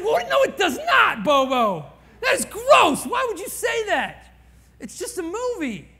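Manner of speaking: high, loud indignant shout; shocked protest. Retold or imitated. Pastor protesting to his friend that a chick flick is just a movie, shocked. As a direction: cry out in disgusted disbelief.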